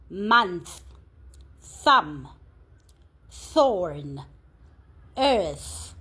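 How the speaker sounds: each word set apart and clearly articulated; spelling list dictation. Shown as a demonstration. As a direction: read it out like a spelling test, word by word. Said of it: "let's do the read through of each word".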